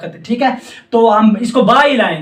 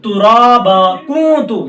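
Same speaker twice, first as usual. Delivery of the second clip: usual speaking voice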